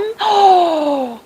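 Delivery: long high gasp; shocked gasp. Real, not acted. Speaker hears viewer still lacks electricity, shocked gasp. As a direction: draw in breath in dismay. Reading of a long power outage.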